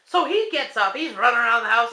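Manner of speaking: ordinary talk, nothing marked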